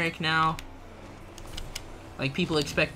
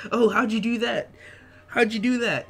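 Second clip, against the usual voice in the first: higher-pitched imitation of impressed onlookers; mock amazement; 5 semitones higher than usual. Streamer imitating people asking about his dab, mock amazed. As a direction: wow over it in a raised, put-on voice.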